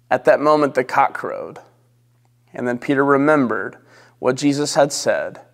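ordinary talk

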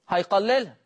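normal talking voice

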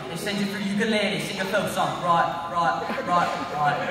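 slurred working-class British accent; low-class British. Shown as a demonstration. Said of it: "we can go to low class British".

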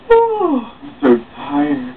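high whew, then slow tired line; mock tired sigh. Woman hinting she needs a place to stay, mock tired. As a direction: fake a weary sigh to get invited.

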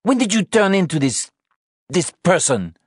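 high, loud, disgusted question; appalled. Acted. Anna confronts drunk Sean, disgusted. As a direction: demand it in dismay, easing off at the end.

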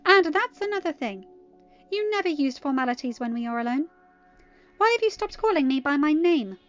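loud, high, demanding; offended highborn mare; indignant scolding. Acted. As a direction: scold him, voice climbing to a question. Scolds him for his formality.

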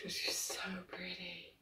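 hushed, breathy whisper of admiration; awed whisper. Real, not acted. Fan gazing at her new doll, whispering in awe. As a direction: whisper it like she's too precious to disturb.

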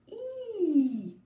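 I, high and drawn out; vowel I. Shown as a demonstration. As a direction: hold a high long I. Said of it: "qui fait le même geste, le même bruit". (I aigu et tenu)

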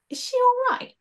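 squeaky high voice of a worried bystander; high, fretful courtier voice; high worried onlooker. Acted. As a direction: ask it like a nervous courtier.

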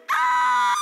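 imp's shriek. Pretend. Preacher voicing the fleeing imp, a high shriek. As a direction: shriek like a startled little imp. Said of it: "that little imp that's whispering goes".

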